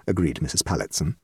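plain reading voice

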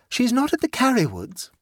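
a hint of a pleased, scatterbrained aunt; light, self-satisfied chatter; gaily. A narrator's reading. Jane's voice.

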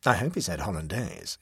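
usual speaking voice

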